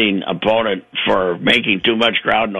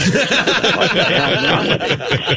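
long high burst of laughter; big laugh; 12 semitones higher than usual. Real, not acted. Host laughing hard at his own joke about Wetmore, big laugh.

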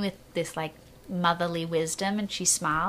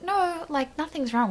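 pitched way up, falsely breezy; nervous high denial; 9 semitones higher than usual. Retold or imitated. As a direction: brush it off too brightly, voice jumping high. Nervous denial, faking calm.

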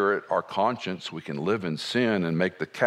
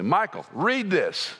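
slow, higher voicing of the old pastor; mentor's gentle command; 10 semitones higher than usual. Preacher retelling his mentor's words. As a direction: hand over the Bible, slowly and kindly.

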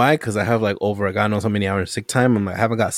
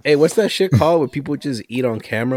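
usual speaking voice

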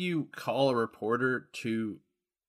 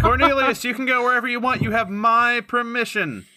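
high, loud mock-formal grant; grand permission; 10 semitones higher, 8 dB louder than usual. Son grandly dismissing the reporter outside. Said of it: "and I'm like".

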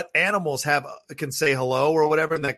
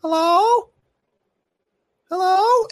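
high thin calling hello, far off; spooky high-pitched call of hello; eerie high hello; 6 dB louder, much slower than usual. Retold or imitated. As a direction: mimic the creature calling out high. Imitating the creature's high call.

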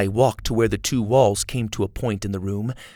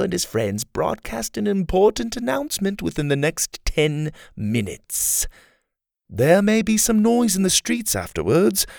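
villain mocking an official, higher; Kai, cocky; 9 semitones higher than usual. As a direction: sneer politely at the fat chancellor. Kai threatening politely.

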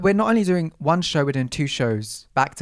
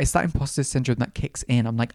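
ordinary talk, nothing marked

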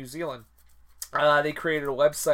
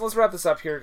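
usual speaking voice

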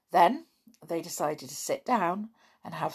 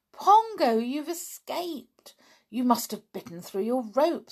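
excited; 7 semitones higher than usual. Acted. Julian greets the returned chimp.

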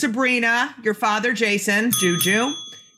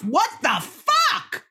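amazed exclamation; 9 semitones higher, much slower than usual. Real, not acted. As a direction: drag it out in disbelief. Host marvels at guest's life.

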